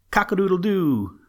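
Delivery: loud high rooster crowing. A narrator's reading. The old red rooster waking the farm, crowing loud. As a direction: crow it out like a rooster at dawn. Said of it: "went the old red rooster".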